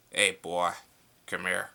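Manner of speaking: put-on loud, gruff old-style Homer Simpson. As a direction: bark it like early Homer. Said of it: "when he used to talk like this".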